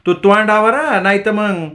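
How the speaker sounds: wife raises her voice at husband. Acted. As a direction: raise your voice and threaten. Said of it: "ताराबाईने आवाज उंचावला".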